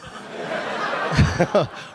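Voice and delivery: wheezing laugh at his own joke; breathless laugh. Real, not acted. Comedian cracking up at his own dad joke, wheezy laugh. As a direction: laugh silently at the bit.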